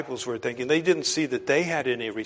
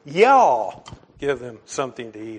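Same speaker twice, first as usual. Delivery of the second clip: a joking Oklahoman "Y'all" for the home crowd; Oklahoma y'all; 6 dB louder than usual. Shown as a demonstration. Pastor teasing former Oklahomans in the pews, mock Oklahoma accent. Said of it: "for the sake of our, uh, quite a few former Oklahomans".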